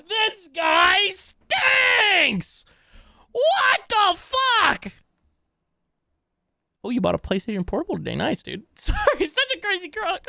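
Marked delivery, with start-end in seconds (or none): 0.1-4.9 a real outburst of disgust at what he hears; outraged yell. Real, not acted. Outraged reaction to streamer's story.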